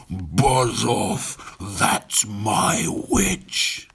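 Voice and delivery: slow, monstrous growling beast voice; the beast warns the dragon off the witch; terrible beast. Acted. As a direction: drag it out like a swamp monster. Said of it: "its terrible voice".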